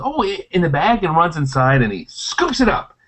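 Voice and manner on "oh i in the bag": high, gullible squirrel voice; eager squirrel. Acted. Squirrel lured by promised nuts, eager. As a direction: play a squirrel falling for the trick. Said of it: "And the squirrel says".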